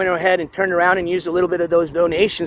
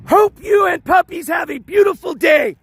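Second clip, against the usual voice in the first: donation yell; 10 semitones higher than usual. Real, not acted. Streamer shouting a donation message to a viewer. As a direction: give it everything he has left. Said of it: "it's gonna be embarrassing".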